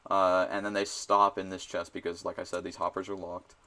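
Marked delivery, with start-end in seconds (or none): none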